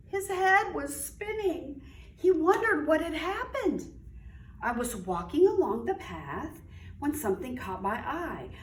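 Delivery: lively storybook read-aloud; reading to children. A narrator's reading. Teacher reading aloud to young children, animated storytelling. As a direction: read it like story time for little kids.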